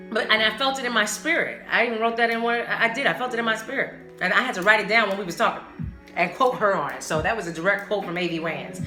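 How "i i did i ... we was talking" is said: usual speaking voice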